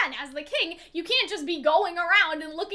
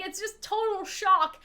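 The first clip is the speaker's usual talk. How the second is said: usual speaking voice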